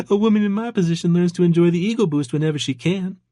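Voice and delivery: high, flirty Georgia waitress; amused Georgia accent. A narrator's reading. Married waitress inviting flirting, amused Georgia accent. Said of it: "an accent that was distinctly from Georgia".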